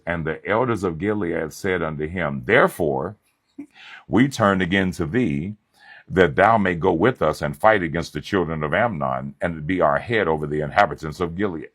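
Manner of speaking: solemn, declaiming Bible reading; scripture reading. Acted. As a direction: read the verse out like from the pulpit.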